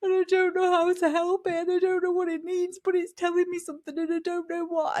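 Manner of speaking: high, strained voice of someone breaking down in tears; crying. Retold or imitated. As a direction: plead helplessly through retold tears. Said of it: "I burst into tears".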